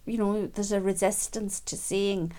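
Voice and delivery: ordinary delivery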